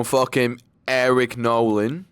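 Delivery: put-on drawn-out Australian accent; slow the words into an Australian accent. Fight picker naming the next fighter, Australian accent. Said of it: "I did an Australian accent".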